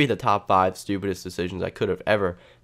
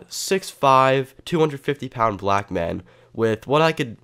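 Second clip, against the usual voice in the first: normal talking voice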